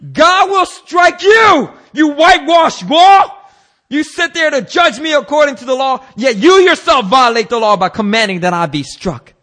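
high, loud acted denunciation; stretched-out shout opening into a loud tirade; furious rebuke. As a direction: thunder it like a wronged prophet. Acts Paul rebuking the high priest.

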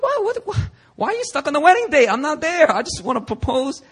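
high, fast, bewildered protest; can't believe what he's hearing; baffled disbelief. Retold or imitated. Retold confused reaction to dad.

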